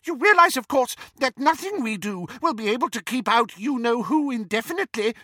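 high squeaky little wizard. A narrator's reading. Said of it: "squeaked Flitwick".

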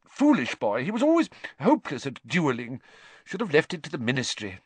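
lightly voiced upset teacher; upset scolding. A narrator's reading. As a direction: scold through mild grief. Grieving scolding after tears.

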